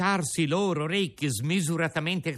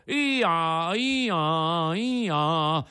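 hee-haw bray, loud and higher; 4 semitones higher, 5 dB louder, much slower than usual. Acted. Two boys turned donkeys trying to lament, braying loudly. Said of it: "ragliando sonoramente".

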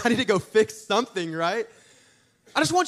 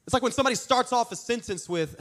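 ordinary talk

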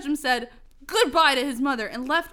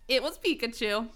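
ordinary talk

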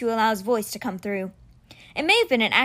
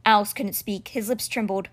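ordinary delivery

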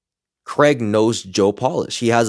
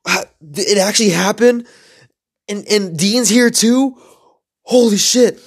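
high-pitched stunned disbelief; amazed, stammering double takes; freaking out; 7 semitones higher, 4 dB louder than usual. Retold or imitated. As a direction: replay the shock, voice climbing higher as it sinks in. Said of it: "I'm, like, freaking out in my mind".

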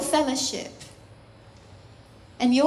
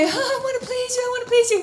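high-pitched, anxious-to-please mock voice; eager believer; 9 semitones higher, much faster than usual. Preacher mimics believers striving to please God, high and eager. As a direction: plead eagerly, pitched well up. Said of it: "When we say".

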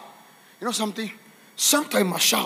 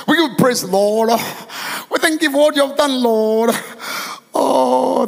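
drawn-out cries to the Lord; fervent pleading prayer; slower than usual. Acted. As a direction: plead in prayer, stretching out the opening words.

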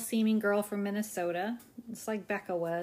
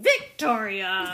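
scolding a dog; 9 dB louder, much slower than usual. Retold or imitated. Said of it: "treat her like she's a, an, a dog that's misbehaving".

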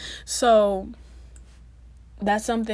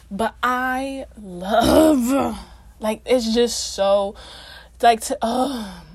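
slow, drawn-out, louder swoon; 5 dB louder, much slower than usual. Real, not acted. Vlogger swooning over gap teeth, slow and loud. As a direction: gush as if overwhelmed. Overcome by how cute gaps are.

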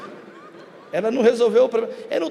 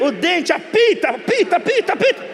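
mock exasperation; 5 semitones higher than usual. Mocking beeping people at airport.

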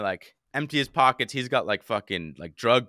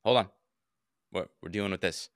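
low, quiet brush-off, imitating a busy officer; dismissive cops; 5 semitones lower, 4 dB quieter than usual. Busy cop brushing off a theft victim, imitated dismissively. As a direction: put them off in a lowered mumble. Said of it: "they basically were just like this".

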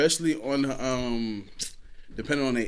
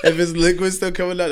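high loud laugh into speech; bursting out laughing; 8 semitones higher, 7 dB louder than usual. Real, not acted.

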